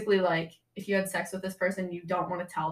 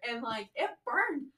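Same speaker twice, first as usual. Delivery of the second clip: normal talking voice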